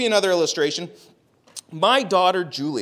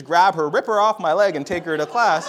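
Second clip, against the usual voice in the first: ordinary talk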